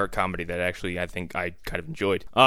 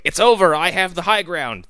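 higher, louder quoted declaration; mock triumphant call; 6 semitones higher, 5 dB louder than usual. Davey to Roscoe from halfway across, triumphant call. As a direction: call it out like a movie hero.

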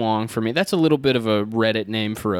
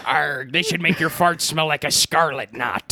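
slow gruff pirate arr; 4 semitones higher, slower than usual. Pretend. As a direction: talk like a pirate captain. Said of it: "I'm not a pirate".

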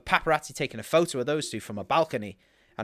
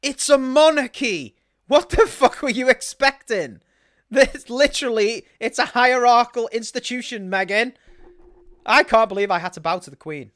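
retort to Meghan; 4 semitones higher, 5 dB louder than usual. Retold or imitated. Host acting out his retort to Meghan, exasperated and loud. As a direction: lecture her as if she's daft. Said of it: "I'm like".

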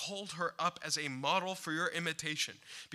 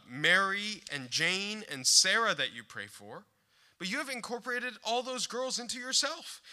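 ordinary talk

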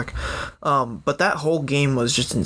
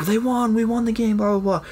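higher, excited report of the win; excited retelling; 8 semitones higher than usual. Retold or imitated. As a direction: blurt out the good news. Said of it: "We're like".

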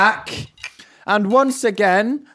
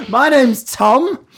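laughing; 6 semitones higher, much slower than usual. Real, not acted. Intro while laughing.

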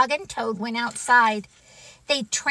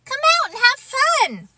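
very high, louder invitation; excited urging; 6 dB louder, faster than usual. Acted. Frog coaxing reluctant Toad outside, excited. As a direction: coax with bubbly enthusiasm.

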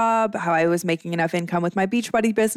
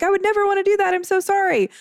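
imitating her old self, much higher and eager to please; over-apologetic; 12 semitones higher, faster than usual. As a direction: gush apologies in a high, anxious voice. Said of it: "I was just so apologetic".

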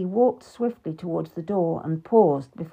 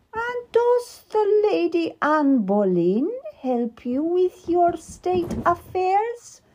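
higher, slow, pointed sarcasm; sarcastic; 11 semitones higher, slower than usual. Acted. Queen to King Henry about Anne Boleyn, sarcastic. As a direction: let the question drip with scorn. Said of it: "the Queen asked sarcastically".

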